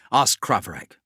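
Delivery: ordinary talk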